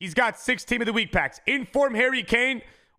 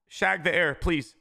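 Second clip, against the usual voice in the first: ordinary talk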